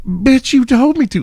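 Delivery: high, loud mocking comeback; hammed-up high retort. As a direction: fire back in a high mock voice.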